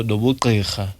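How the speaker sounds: ordinary delivery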